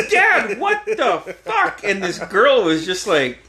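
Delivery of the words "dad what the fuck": very high, slow mock outrage; mortified outrage. Son protesting dad's remark before girlfriend, mortified outrage. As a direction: stretch out an appalled protest, pitched way up.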